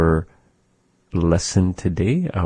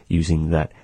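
ordinary delivery

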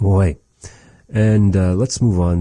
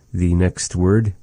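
ordinary delivery

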